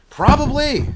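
a sudden loud high probably; loud exclaim. Real, not acted. Answering his own question with a loud probably.

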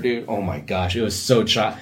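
normal talking voice

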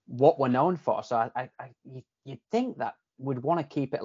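usual speaking voice

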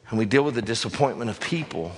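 normal talking voice

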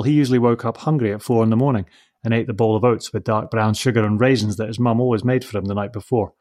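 usual speaking voice

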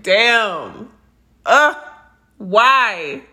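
exasperated cry. Retold or imitated. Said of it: "I just feel like".

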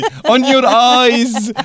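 put-on slow, laughing shopkeeper English in a Levantine accent; levantine arabic English. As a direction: drawl it out like a Levantine grocer with a chuckle. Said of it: "يعني تكرم عينك بالإنجليزي؟".

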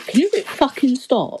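half-laughing irritated plea; annoyed high-pitched snap. Real, not acted. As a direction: drag out a high exasperated complaint. Telling friend to stop crunching.

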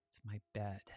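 sigh, then low and very soft; reluctant confession. Acted. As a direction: let the secret out under your breath. Avery reluctantly confesses secret.

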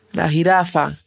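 plain reading voice

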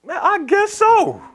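high, drawn-out mock whine; reluctant giving-in. Preacher answering the Lord's question, hammed-up reluctant giving-in. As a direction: give in with exaggerated reluctance.

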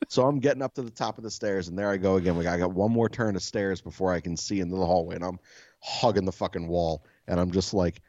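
ordinary delivery

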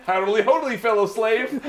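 high, chirpy neighborly voice with a laugh; a hint of cheerful Flanders; Flanders greeting. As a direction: greet them like an eager neighbor.

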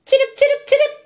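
very high, loud bird chirps; chirping sparrows. A narrator's reading. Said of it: "say the sparrows".

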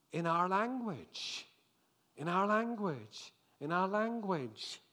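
astonished crowd, voiced by a preacher; utterly amazed. A narrator's reading. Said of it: "Utterly amazed, they asked".

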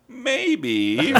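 very high, drawn-out imitation of a mother; mock sweet answer. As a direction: sing out the polite dodge in a high voice. Imitating mother's diplomatic answer to child.

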